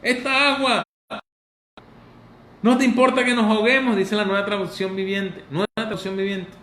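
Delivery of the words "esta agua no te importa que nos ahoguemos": high, whiny complaining voice; mock whining disciple. Disciple complaining to sleeping Jesus, high mock whine. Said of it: "Y uno diciendo".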